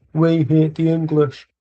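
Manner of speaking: imitating a Scottish fan with a put-on accent; mock Scottish fan. English fan mocking Scottish fans, jeering put-on accent. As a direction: jeer it like a rival Scot. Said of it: "Scottish people are like".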